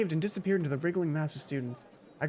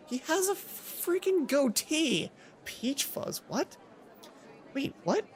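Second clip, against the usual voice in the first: incredulous; 8 semitones higher, 3 dB quieter, slower than usual. Acted. Correcting Mai, incredulous.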